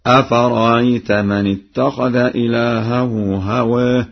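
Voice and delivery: أفرأيت said afarayt, hamza softened; eased second hamza. Shown as a demonstration. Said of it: "بتسهيل الهمزة الثانية بين بين". (أفرأيت بتسهيل الهمزة بين بين)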